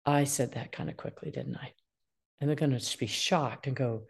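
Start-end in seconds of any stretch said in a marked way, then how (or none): 0.1-1.7 gentle self-correction. Shown as a demonstration. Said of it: "wipe that spaghetti sauce up easily".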